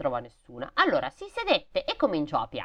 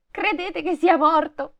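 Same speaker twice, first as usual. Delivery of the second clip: put-on much higher, louder anxious child; girl's voice; 11 semitones higher, 5 dB louder than usual. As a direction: fear for Kai in a child's voice. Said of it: "chiese alle rose". (bambina angosciata, molto acuta)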